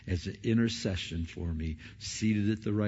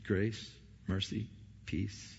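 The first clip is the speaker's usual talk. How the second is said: Grace mercy peace slowed and much softer; soft grace greeting; 3 semitones lower, 9 dB quieter, slower than usual. Acted. Preacher savouring Paul's greeting, soft and slow. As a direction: let Grace mercy peace fall gently.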